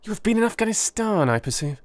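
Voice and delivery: lightly voiced eager scientist; a hint of a keen young chemist; eager Holmes. Holmes explaining his new reagent, eagerly.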